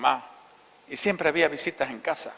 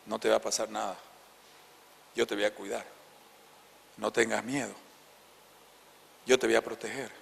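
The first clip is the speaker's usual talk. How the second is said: softer, lower, tender comforting; father reassuring; 4 semitones lower, 7 dB quieter than usual. Retold or imitated. Father comforting his frightened son on his lap, soft and tender. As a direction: soothe a scared child gently. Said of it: "y me decía".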